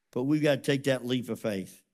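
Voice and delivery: ordinary delivery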